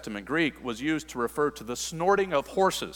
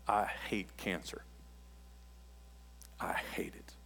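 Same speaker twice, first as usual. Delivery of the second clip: soft, slow, near-whispered hatred; bitter hushed vow; 6 semitones lower, 15 dB quieter than usual. Retold or imitated. Someone leaving a loved one's hospital room, hushed and bitter. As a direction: breathe it out like grief turned to anger.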